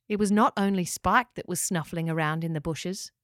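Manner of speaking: usual speaking voice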